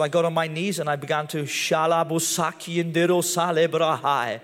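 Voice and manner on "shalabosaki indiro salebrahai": slow, put-on tongues prayer; speaking in tongues. Praying in tongues by his bed at Bible school. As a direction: pray it out in tongues, unhurried. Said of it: "Started to speak in tongues".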